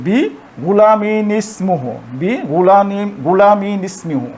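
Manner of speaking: গুলামিন ইসমুহু joined slowly as গুলামিনিসমুহু; linked recitation. Shown as a demonstration. Teacher modeling linked recitation slowly for learners. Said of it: "মিলাই পড়ানো হচ্ছে".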